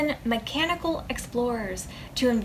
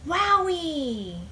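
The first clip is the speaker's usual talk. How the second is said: high, drawn-out wowee of wonder; amazed wowee; 6 semitones higher than usual. Retold or imitated. Boy hearing Sagan on TV, amazed. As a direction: marvel at it like a kid. Child marveling at star stuff.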